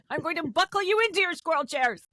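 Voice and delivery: high, fast, sing-song bossy voice; cutesy voice for squirrels. Pretend. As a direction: boss tiny squirrels around in a high voice. Ordering squirrels into tiny chairs.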